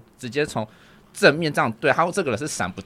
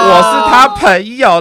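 slow, louder, higher mock quote of the stranger; retold reply; 9 semitones higher, 12 dB louder, much slower than usual. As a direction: drag out 我是他朋友 loudly, hammed-up. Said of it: "他就说".

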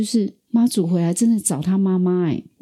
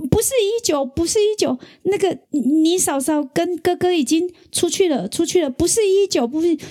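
imitating a frantic dad in tears, much higher; panicked crying father; 12 semitones higher than usual. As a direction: pitch jumps high, mock-panicked and tearful. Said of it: "完全就是被那一个景象吓傻了". (模仿父亲哭着惊慌地说，声音高很多)

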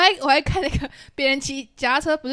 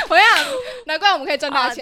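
laughing; 6 semitones higher, 6 dB louder, faster than usual. Real, not acted. Laughing banter.

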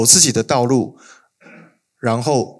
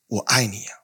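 hollow declaration; 8 dB quieter than usual. Acted. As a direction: murmur it like an empty promise. Israel's hollow love claim. (轻声空洞地说)

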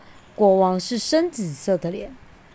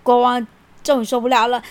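ordinary delivery, nothing marked

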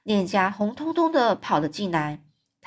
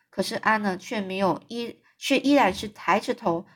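ordinary delivery

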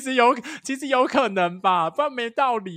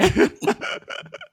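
high airy laugh. Real, not acted. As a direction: burst of high breathless laughter. Laughs at scheming squad.

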